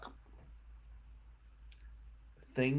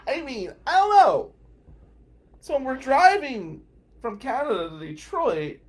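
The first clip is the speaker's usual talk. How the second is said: usual speaking voice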